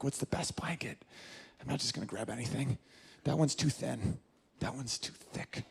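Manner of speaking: very soft, half-whispered musing; acted private fussing, barely voiced; hushed deliberation. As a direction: mutter to himself while choosing with great care. Man privately picking out a blanket.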